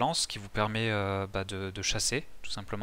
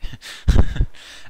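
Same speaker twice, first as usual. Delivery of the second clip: laugh; 15 dB louder than usual. Real, not acted. Laughs at unexpected craft.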